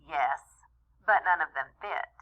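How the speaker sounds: plain reading voice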